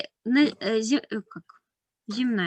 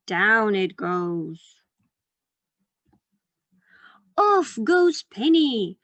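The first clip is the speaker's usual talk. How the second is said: put-on reading to a toddler; 5 semitones higher, slower than usual. Reads picture book to children.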